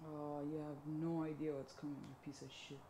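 low, softening threat under the breath; quiet menace. Real, not acted. Viewer to the villain on screen, quiet menace. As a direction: drop low and soft, savouring what awaits him.

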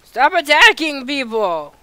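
loud, high, slow reprimand; scolding shout. Real, not acted. Player scolding someone for attacking people, shouting. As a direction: scold loudly, stretching the words.

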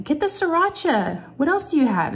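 put-on playful high voice for the kids; excited kids. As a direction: imitate eager girls egging each other on. Imitating kids daring each other.

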